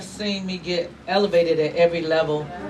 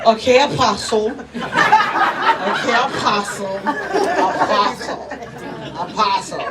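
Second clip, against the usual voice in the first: sing-song yes-boss act, drawn out; patient assistant; 2 semitones higher, 5 dB louder, slower than usual. Retold or imitated. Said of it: "Deborah just ain't gonna say".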